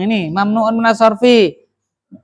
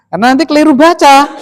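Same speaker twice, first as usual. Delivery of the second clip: urgent warning; 8 semitones higher, 8 dB louder, much faster than usual. Real, not acted. Teacher warns class emphatically.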